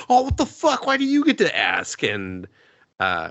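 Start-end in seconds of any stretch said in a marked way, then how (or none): 0.1-2.0 hammed-up indignation, very high; indignant heroine. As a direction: protest like a cheated rival.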